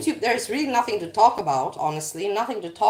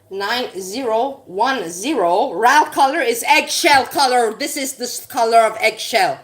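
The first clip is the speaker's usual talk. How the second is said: slow, louder, leaning on eggshell; emphatic insisting; 5 dB louder, slower than usual. Real, not acted. To viewers, insisting she knows her colours, louder and slow. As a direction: set them straight on the colour.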